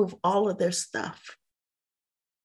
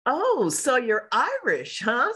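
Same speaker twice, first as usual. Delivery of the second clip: higher, slow, drawn-out quote of the homeowner; Patricia Sheridan; 5 semitones higher, slower than usual. Retold or imitated. Homeowner quizzing a caller on the phone, imitated. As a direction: imitate a probing phone inquiry, pitched up. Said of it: "I do quote on the phone".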